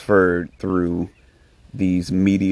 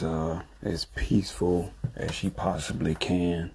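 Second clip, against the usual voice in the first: soft, hushed voice so as not to wake someone; low talking; 6 dB quieter than usual. Real, not acted. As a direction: keep it low, talking quietly. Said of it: "kinda low talking right now 'cause I don't want to disturb her".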